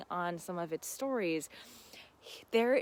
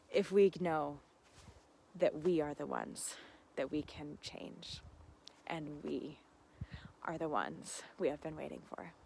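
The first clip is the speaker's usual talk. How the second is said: soft sincere close; 4 semitones lower, 7 dB quieter than usual. Real, not acted. Closing heartfelt message.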